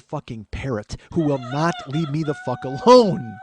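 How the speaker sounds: slow, building comic outburst; exasperated rant. Acted. Nephew with aunt on his shoulder, exasperated rant. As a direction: build to a loud, worked-up last word.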